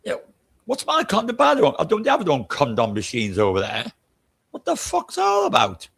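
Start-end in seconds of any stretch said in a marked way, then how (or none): 4.6-5.9 outraged exclamation. Real, not acted. Outraged rant about condoms.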